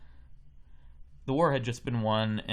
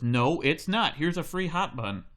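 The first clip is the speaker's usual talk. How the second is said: dad raising his voice, a bit louder; father ending the argument louder; 5 semitones higher, 4 dB louder than usual. Retold or imitated. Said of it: "Dad gets really loud and goes".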